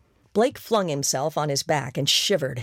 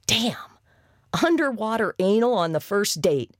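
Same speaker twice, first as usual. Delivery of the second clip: shocked exclamation; 6 semitones higher than usual. Acted. Shocked female outburst.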